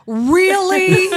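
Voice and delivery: mock-incredulous drawn-out "Really?", high and loud; Marion Gabrick. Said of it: "he's super short and he goes".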